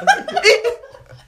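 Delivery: high, loud burst of real laughter; cracking up at his own story; big laugh. Real, not acted. Storyteller laughing hard at the punchline, loud real laughter.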